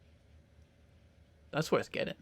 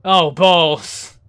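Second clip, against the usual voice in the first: louder, hammed-up oh balls; mock dismay; 8 dB louder than usual. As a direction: repeat it big and theatrical. Repeated loud in put-on voice.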